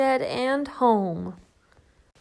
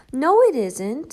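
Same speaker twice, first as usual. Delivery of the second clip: defiant; 4 semitones higher, 8 dB louder than usual. Acted. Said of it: "said Shelley".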